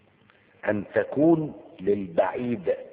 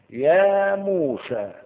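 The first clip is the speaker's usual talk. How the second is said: "يا موسى" stretched very slow, high and loud; long drawn-out call; 10 semitones higher, 7 dB louder, much slower than usual. Shown as a demonstration. Teacher shows the far call in a Quran verse, long and loud. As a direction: call "يا موسى" across a great distance, slowly. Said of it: "بحرف النداء الذي يستخدم للبعيد".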